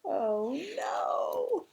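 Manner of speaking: high, swooping storybook dismay; mock dismay. A narrator's reading. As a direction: wail it lightly for the children. Knot slips in the story.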